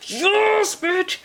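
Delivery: high, loud celebratory yell; real glee, shouted; triumphant cheer. Real, not acted. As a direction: cheer as if you just won. Celebrating the fiber installation.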